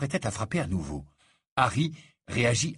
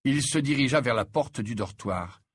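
plain reading voice

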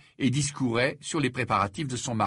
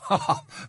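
a quick breathless laugh bursting out; breathy snort of laughter; 8 semitones higher than usual. A narrator's reading. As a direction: let an airy laugh burst out. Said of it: "Ron éclata de rire".

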